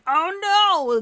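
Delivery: high, loud hammed-up gasp of dismay; mock alarm. Host reacting to the missing woman, in mock alarm. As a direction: fake horror for comic effect.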